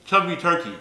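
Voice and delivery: words mushed by marshmallows; cheeks stuffed full. Real, not acted. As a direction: push it out past a packed mouth.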